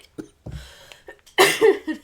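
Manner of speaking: loud hacking cough; a long loud coughing fit. Real, not acted. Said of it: "Now I'm coughing".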